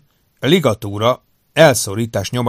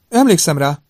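higher, louder woman's voice, pleasantly modulated; lightly voiced doctor with a well-modulated tone; 7 semitones higher, 7 dB louder than usual. A narrator's reading. Doctor recalling a patient for Davenport, pleasantly modulated voice. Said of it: "kellemesen modulált hangon a doktornő".

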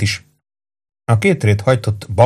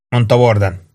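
usual speaking voice

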